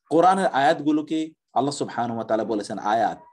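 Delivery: usual speaking voice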